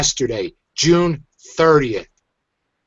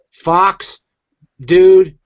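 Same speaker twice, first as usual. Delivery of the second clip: loud shout-out; 4 semitones higher, 8 dB louder than usual. Real, not acted. Thanking the video maker loudly.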